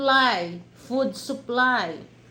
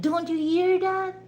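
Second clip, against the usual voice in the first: exasperated; 9 semitones higher, 3 dB louder than usual. Retold or imitated. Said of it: "in my mind".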